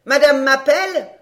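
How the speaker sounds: loud, called-out question; calling out. A narrator's reading. Maid answering the countess from upstairs, calling out. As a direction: call back as a maid answering from afar. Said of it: "cria la camériste".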